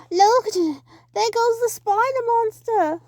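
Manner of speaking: high loud slowed exclamation; excited shout. Acted. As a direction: point it out breathlessly. Chase scene.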